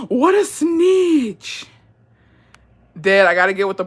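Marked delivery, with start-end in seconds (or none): 0.1-1.7 high, slow, stretched gasp of outrage; mock scandalized.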